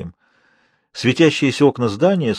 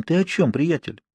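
falsely gentle threat; 5 semitones higher, 4 dB quieter than usual. Acted. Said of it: "притворно-ласковом тоне таилась такая же смертельная угроза".

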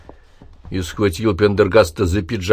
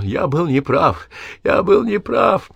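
slow wheeze climbing much higher; wheeze rising to a cry; 10 semitones higher, slower than usual. A narrator's reading. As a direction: build from rasp to desperate cry. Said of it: "хрипел он".

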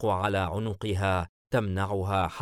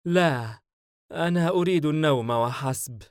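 higher, worn-out plea; tired voice; 7 semitones higher, 5 dB louder than usual. A narrator's reading. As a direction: plead tiredly to be left to sleep. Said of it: "دنهش بصوت متعب". (صوت متعب)

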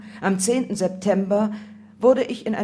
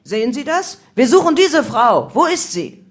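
loud, high-pitched, pressing questions; shouted interrogation; 6 semitones higher, 9 dB louder than usual. A narrator's reading. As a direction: demand answers from a suspect at full voice. Raid leader interrogates with photo.

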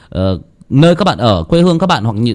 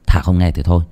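usual speaking voice

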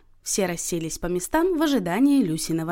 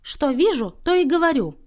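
higher, louder, huffy retort; sulky defiance; 7 semitones higher, 5 dB louder than usual. A narrator's reading. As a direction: stand your ground, offended. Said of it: "насупилась та". (насупившись, с вызовом)

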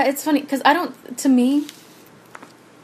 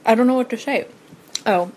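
normal talking voice

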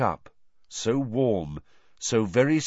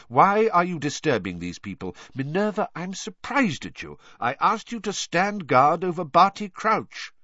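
higher-pitched, stern questioning; Dumbledore's sharp rebuke; 8 semitones higher, 3 dB louder than usual. A narrator's reading. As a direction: sharply take a colleague to task. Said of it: "said Dumbledore sharply".

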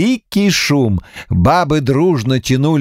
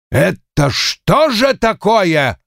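slow, ominous questioning, pitched up; зловещий вопрос; 6 semitones higher, slower than usual. A narrator's reading. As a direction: ask it slowly with menace. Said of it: "зловеще спросил он у щукаря". (зловеще, медленно, с угрозой)

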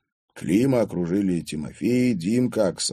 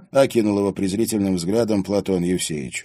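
ordinary delivery

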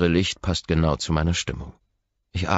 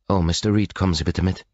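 nervous receptionist; 4 semitones higher, 4 dB louder than usual. Acted. Young man nervous.

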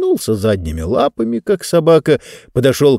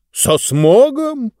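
scornful drawl; 12 semitones higher, much slower than usual. Acted. Speaker scoffs at smog.

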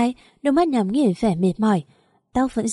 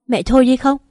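higher, louder snap; angry outburst; 4 semitones higher, 6 dB louder than usual. Acted. Daughter-in-law snapping at her mother-in-law to stop. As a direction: cut her off sharply.

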